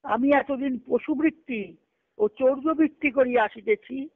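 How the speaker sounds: normal talking voice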